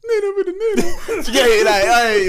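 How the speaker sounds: high, laughing punchline. Real, not acted. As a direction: laugh through the words. Laughing retelling his line.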